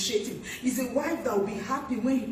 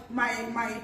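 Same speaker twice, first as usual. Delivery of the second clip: ordinary delivery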